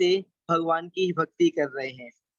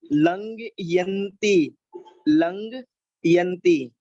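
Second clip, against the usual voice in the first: लंघयन्ति drawn out slowly, the second take louder; slow verse recitation; 4 dB louder, much slower than usual. Shown as a demonstration.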